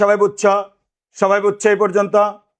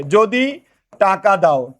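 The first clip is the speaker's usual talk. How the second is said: slow dictation; much slower than usual. Shown as a demonstration. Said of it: "teacher dictating slowly".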